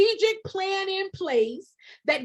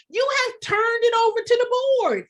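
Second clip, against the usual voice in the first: louder and higher, passionate shouting; real near-shout; 5 semitones higher, 4 dB louder than usual. Real, not acted. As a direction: bear down on it like you're scolding. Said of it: "Am I screaming again?".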